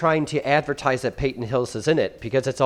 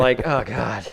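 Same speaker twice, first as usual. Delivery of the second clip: usual speaking voice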